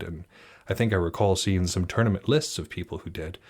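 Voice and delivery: ordinary talk, nothing marked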